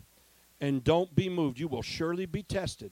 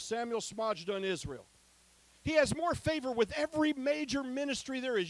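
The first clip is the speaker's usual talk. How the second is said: higher, quicker preacher's boast; preaching; 8 semitones higher than usual. Acted. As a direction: lift it up and testify to the crowd.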